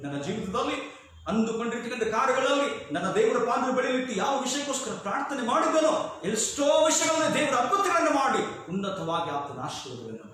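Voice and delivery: high, driving pulpit delivery; impassioned preaching. Acted. Preacher testifying to answered prayers in his life, fervent.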